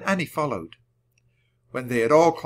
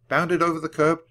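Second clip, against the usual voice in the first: ordinary delivery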